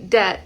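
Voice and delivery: "dead" with its end stopped, unreleased; held final consonant. Shown as a demonstration. Teacher giving another example, held final stop. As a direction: cut "dead" short with no release. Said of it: "como totalmente detenida".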